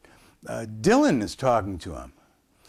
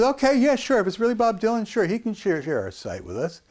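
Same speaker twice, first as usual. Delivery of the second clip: high, mocking, going-along voice; sarcastic humoring; 10 semitones higher than usual. Camper humoring the kid about Dylan, high sarcastic voice. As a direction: play along like you don't believe a word.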